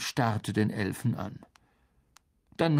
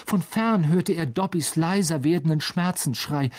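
ordinary delivery

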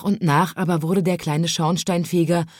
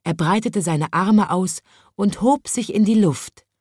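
ordinary delivery, nothing marked

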